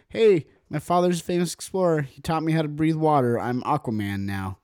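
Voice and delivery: brash, loud and proud boast; cocky hero voice. Pretend. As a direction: announce yourself like a cheesy hero. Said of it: "they're like".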